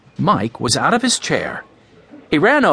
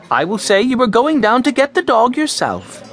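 high-pitched, emphatic mother; mother conceding a point; 9 semitones higher than usual. A narrator's reading. Mother agreeing with her son about the dog, emphatic. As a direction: grant it in a higher woman's voice.

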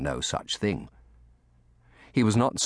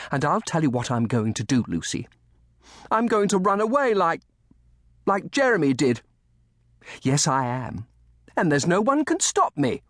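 put-on high-pitched, determined boy; defiant threat; 10 semitones higher, 4 dB louder than usual. A narrator's reading. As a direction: declare it stubbornly as a child. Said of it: "flung back his head defiantly".

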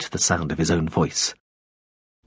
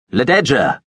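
louder, insistent call of her name, falling at the end; echoing call; 4 semitones higher, 5 dB louder than usual. Acted. Veppers calling for the girl again, insistent loud call. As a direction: call her name so it rings through the space. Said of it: "His voice, her name, echoed".

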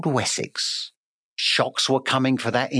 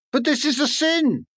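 much higher, startled bird protest; shocked bird; 11 semitones higher than usual. Acted. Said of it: "The bird understood".

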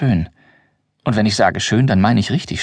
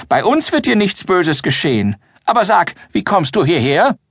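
put-on a doddering old man soothing a girl; whiny old man; 8 semitones higher than usual. Grimbold comforts the girl in his whiny old-man voice. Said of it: "mit seiner weinerlichen Altmännerstimme".

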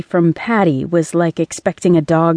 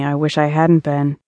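flat, dry monotone; deadpan, no inflection at all; 3 semitones lower than usual. A narrator's reading. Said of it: "a monotone female voice said".

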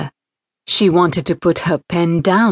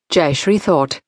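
ordinary delivery

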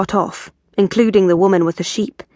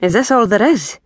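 impatient Scottish-Scandinavian grumble; a touch of irritation, Highland accent kept; irritable; 2 semitones higher than usual. Acted. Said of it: "said Harry irritably".

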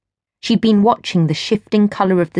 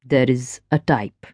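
lower, easy man's voice; smooth stranger; 7 semitones lower, 3 dB quieter than usual. A narrator's reading. Stranger chats to Gwen at the rail, low and easy. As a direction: speak low and relaxed, at ease.

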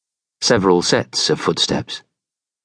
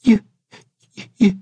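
put-on high-pitched stammering boy; frightened stammer; 13 semitones higher, 3 dB louder than usual. A narrator's reading. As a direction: stammer it out as a scared little boy. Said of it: "he stammered to the stranger".

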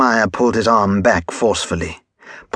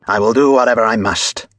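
plain reading voice

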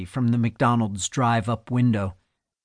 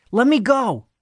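high, loud yell to be let go; 11 semitones higher, 4 dB louder than usual. Acted. Boy pulling free from the cop, yelling high and loud. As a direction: shout it, pulling away. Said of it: "he yelled".

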